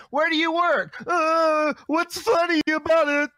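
high, strained mock panic; mock panicked comic.

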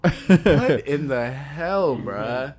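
slow, disbelieving what in the hell; surprised. Retold or imitated. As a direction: stretch out the outrage. Retold reaction.

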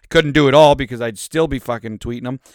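ordinary delivery

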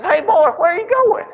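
acted high-pitched questioning holler; puzzled calling out. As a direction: call after someone walking away. Soldier calls after departing boy.

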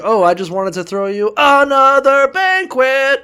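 mock sweet wife luring the boys; hammed-up innocent hostess; sweet woman voice. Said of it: "And she's like".

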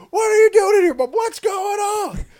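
put-on very high, loud panicked kid; hammed-up high-pitched freak-out; kid losing it. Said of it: "He lost it".

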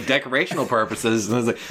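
ordinary delivery

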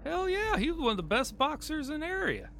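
high, excited outburst; thrilled to hear it. Acted. Excited about the boxer.

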